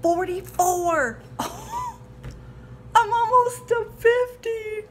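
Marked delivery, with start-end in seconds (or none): 0.0-1.2 mock shock. Real, not acted. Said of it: "It's really frightening". 1.2-2.9 breathless laugh. Real, not acted. Shocked laugh at her age.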